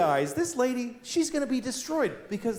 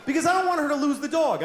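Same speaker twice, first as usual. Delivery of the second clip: excited insistence; 10 semitones higher than usual. Real, not acted. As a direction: insist you really mean it. Defends his harsh wish, laughing.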